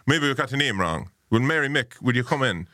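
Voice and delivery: high, singsong Mayo landlady; Mayo accent. Retold or imitated. B and B woman confronting the visitor, Mayo accent. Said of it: "singing way of talking when you're talking".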